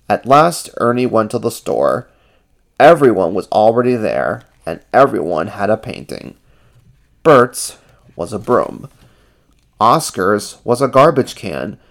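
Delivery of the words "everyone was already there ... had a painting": ordinary delivery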